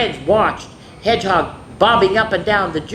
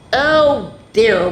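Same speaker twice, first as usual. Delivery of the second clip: wailed; 4 semitones higher, 6 dB louder than usual. Acted. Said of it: "wailed Hedgehog".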